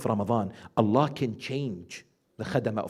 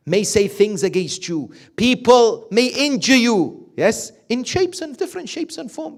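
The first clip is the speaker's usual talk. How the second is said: loud, higher preaching voice, driving each point home; fervent preaching; 8 semitones higher, 9 dB louder than usual. Acted. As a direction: preach it out to the congregation. Preaching at full force.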